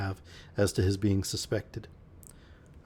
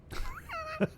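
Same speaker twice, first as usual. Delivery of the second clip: high laugh; 7 dB quieter than usual. Real, not acted. Reader reacts to the story.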